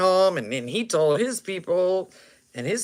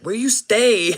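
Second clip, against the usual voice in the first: loud, higher street voice; street slang; 6 semitones higher, 7 dB louder than usual. Retold or imitated. Gang member asking where he lives, loud street voice. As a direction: ask it like a homie. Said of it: "he's like".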